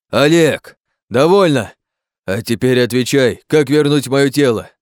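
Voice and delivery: loud, high, forceful; angry demand. Acted. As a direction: give in impatiently, then demand answers.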